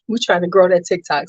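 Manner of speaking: ordinary talk, nothing marked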